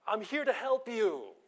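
mock Keystone Cops shout; calling after him. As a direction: chase him down with the words. Chasing fleeing student, calling.

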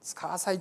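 "つかわさい" said as a quoted dialect word; old Hiroshima word. Shown as a demonstration. Explaining a fading Hiroshima word, "つかわさい" said clearly. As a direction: set "つかわさい" apart, said cleanly as the old dialect form. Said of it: "最近使わない広島弁なんですけどね".

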